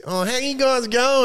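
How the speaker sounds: high, drawn-out mock Aussie greeting; thick Australian accent. Traveller mimicking an Aussie heard overseas, thick Australian accent. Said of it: "was thick Australian accent".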